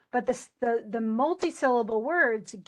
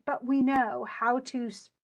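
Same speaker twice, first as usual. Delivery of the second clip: normal talking voice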